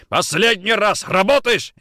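high, loud shout; furious ultimatum. Acted. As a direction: give a last warning in a rage. Interrogator's last furious demand.

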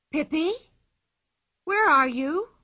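put-on a boy calling for his lost friend; worried call. A narrator's reading. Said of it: "he cried, worried".

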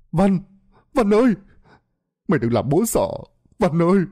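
screaming son's name. A narrator's reading. A father screams for his drowned son at the river. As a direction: call out the boy's name in anguish. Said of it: "gào thét tên con".

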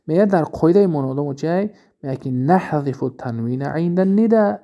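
usual speaking voice

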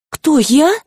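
high startled squeak; startled and scared; frightened. A narrator's reading. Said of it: "перепугалась Рыска".

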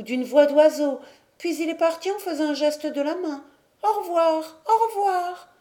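put-on high, hushing girlish confidences; mad sister. A narrator's reading. Mad sister telling of her lost brother's visit, high-voiced confiding. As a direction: play the madwoman sharing her secret in a high voice. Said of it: "Le rire de la folle".

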